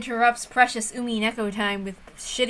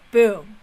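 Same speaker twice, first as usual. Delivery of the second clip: mock gunshot; 6 dB louder than usual. Acted gunshot threat in dialogue.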